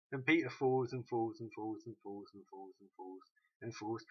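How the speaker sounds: ordinary delivery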